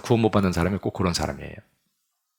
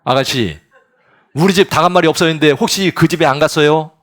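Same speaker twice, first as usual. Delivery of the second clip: loud, higher call across to the next house; calling over the wall; 6 semitones higher, 9 dB louder than usual. Acted. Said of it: "담 너머로 이렇게 물어보잖아요".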